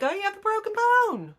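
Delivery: high bright voice, rushed at the end; mocking urgent care staff. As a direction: parody the useless urgent care worker. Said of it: "They'd be like".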